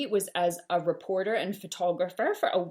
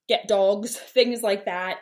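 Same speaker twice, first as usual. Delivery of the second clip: usual speaking voice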